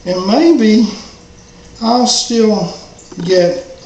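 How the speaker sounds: slow, halting words with long pauses; drifting off to sleep. Acted. Dog drifting off in sleep as the shot takes hold, halting and fading. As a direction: let each word sink into sleep. Dog falling asleep from injection.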